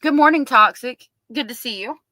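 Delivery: high, loud morning hello; excited greeting. Real, not acted. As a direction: greet a regular warmly. Greeting a viewer.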